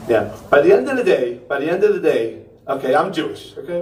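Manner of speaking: ordinary talk, nothing marked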